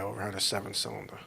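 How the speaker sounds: breathy whispered afterthought; a rueful under-the-breath whisper; whispered aside. Real, not acted. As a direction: drop to a bitter whisper. Bitter aside about the bad engine.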